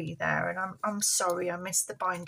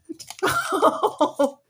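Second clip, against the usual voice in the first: high puppet laugh; 8 semitones higher, 3 dB louder than usual. Pretend.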